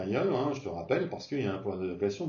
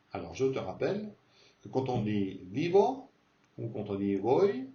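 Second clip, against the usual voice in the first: normal talking voice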